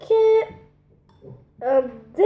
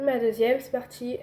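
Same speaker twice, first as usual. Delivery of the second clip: ordinary talk, nothing marked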